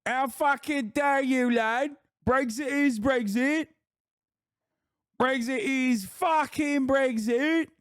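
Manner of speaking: slow, high-pitched indignant British lad; hammed-up British outrage, stretched and squeaky; mock British accent. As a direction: drag out each word in an absurd high British accent. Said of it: "British people are like".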